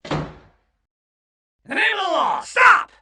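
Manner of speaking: high, loud police order; officer yelling at the villain to stop; commanding shout. Acted. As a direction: bellow it to halt him.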